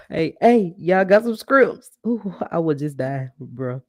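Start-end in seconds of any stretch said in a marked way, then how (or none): 0.4-1.9 high, loud, slow yell for scrimps; mimicking Buddy yelling. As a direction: holler the order across the restaurant, drawn out and louder. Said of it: "you don't want nobody in the restaurant".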